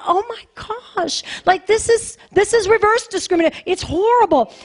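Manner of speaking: high-pitched, appalled reaction retold; retold shock. Retells own shocked reaction to video.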